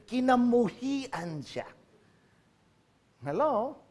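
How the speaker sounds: ordinary talk, nothing marked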